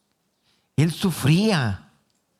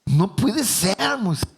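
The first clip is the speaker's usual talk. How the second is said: desperate God; 8 semitones higher than usual. Retold or imitated. God reminding Moses who he is, exasperated. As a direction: declare your power, fed up. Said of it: "Dios se desesperó".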